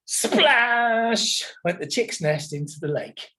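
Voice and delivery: plain reading voice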